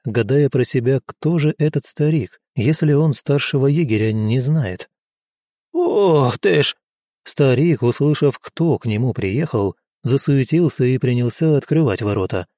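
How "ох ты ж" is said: put-on slow, high startled gasp of an old man; old man caught off guard. A narrator's reading.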